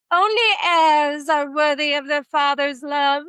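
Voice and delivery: hammed-up aristocratic English; slow, overdone British royal baby voice; bad posh accent. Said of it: "Forgive my accent, I suck at accents".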